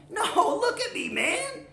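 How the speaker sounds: very high-pitched squawk; incredulous protest. Acted. Middle goat arguing with troll, protesting high. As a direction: squeal it in disbelief.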